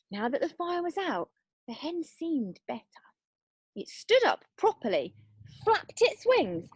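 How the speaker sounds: usual speaking voice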